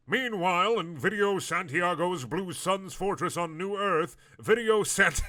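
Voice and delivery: high, loud, slow announcer voice; dramatic narrator. Acted. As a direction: boom out the scene change. Dramatic scene-change narration.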